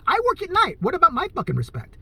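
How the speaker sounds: ordinary talk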